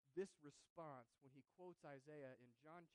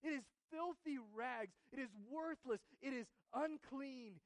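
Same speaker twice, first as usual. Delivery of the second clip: anguished confession; 10 semitones higher, 14 dB louder than usual. Acted. Isaiah confessing his best work is filthy rags, loud anguish. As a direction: cry out that his best is worthless. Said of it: "And he says".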